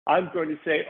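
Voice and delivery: usual speaking voice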